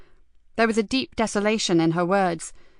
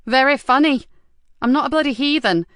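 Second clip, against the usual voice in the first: higher and louder, stung reply; indignant retort; 6 semitones higher, 5 dB louder than usual. Acted. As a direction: snap back, offended. Nikki bristles at the super's jibe.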